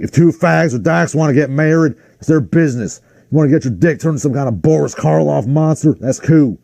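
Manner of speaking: put-on quoting a comedy bit in a Southern accent. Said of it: "he puts on a southern accent".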